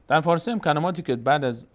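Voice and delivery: ordinary talk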